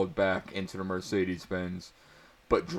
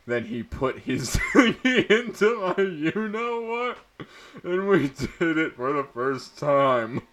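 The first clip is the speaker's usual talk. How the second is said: laughing; 9 semitones higher, 5 dB louder than usual. Real, not acted. Reader cracking up mid-sentence.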